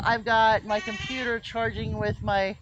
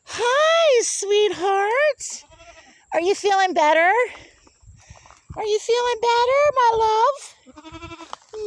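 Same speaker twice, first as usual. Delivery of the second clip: high tender pet voice; 12 semitones higher, slower than usual. Real, not acted. Greeting sick baby goat.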